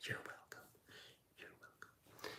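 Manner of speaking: barely audible unvoiced whisper; hushed, dwindling whisper; fading whisper. Smug line repeated, sinking to whisper.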